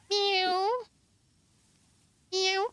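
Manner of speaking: playful meowing. Pretend. Imitating cat sound.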